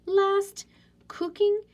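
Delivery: high and loud, bursting with joy; excited. Shown as a demonstration. Teacher reading her favorite sentence excitedly. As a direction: sing it out bright and loud.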